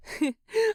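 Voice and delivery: high bright giggle; grinning laugh. A narrator's reading. Fili laughing with Bilbo on the porch, a high giggle. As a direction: giggle with a grin. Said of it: "Fili grins".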